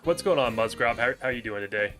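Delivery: ordinary delivery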